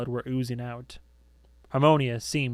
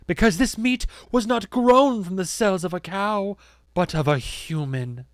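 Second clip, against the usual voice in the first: high, loud denunciation dropping at the end; theatrical proclamation; public announcement; 7 semitones higher, 4 dB louder than usual. A narrator's reading. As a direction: reveal the scandal to a crowd, then land it gravely. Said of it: "announced Harmonia to her audience".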